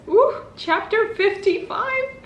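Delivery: wowed by the page count; drawn-out high surprise; surprised ooh. Real, not acted. Flipping to the last chapter.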